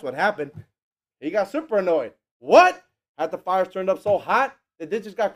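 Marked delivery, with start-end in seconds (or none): 2.4-2.8 outraged disbelief. Retold or imitated. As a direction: burst out in disbelief. King's outraged reaction.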